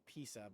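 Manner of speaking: "pizza" with no T, like Pisa; accented pronunciation. Retold or imitated. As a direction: say "pizza" as PEE-suh. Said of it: "I've heard of say".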